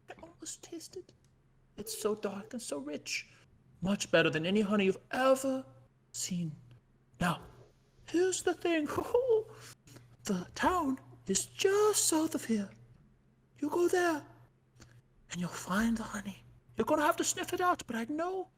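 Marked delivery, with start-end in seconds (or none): 0.1-3.3 breathy hushed whisper. Acted. Bear NPC dreaming of dense honey, whispering. 15.3-16.4 secretive hushed whisper. Acted. Bear NPC telling the crew where the honey is, whispering.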